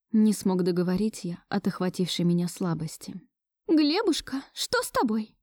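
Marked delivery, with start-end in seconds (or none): 3.7-5.4 higher-pitched, mildly alarmed question; concerned grandmother fussing over him; worried grandmother. A narrator's reading. Grandmother seeing her grandson weaken, worried. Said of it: "почему вдруг забеспокоилась бабушка".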